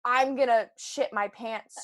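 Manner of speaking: ordinary talk, nothing marked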